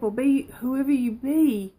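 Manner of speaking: ordinary talk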